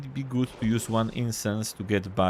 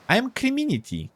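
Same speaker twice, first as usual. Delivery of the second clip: lightly squeaky crab, pitched high; 12 semitones higher than usual. Acted. Crab giving its name, lightly squeaky voice. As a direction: introduce yourself as a high little crab. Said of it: "a squeaky voice".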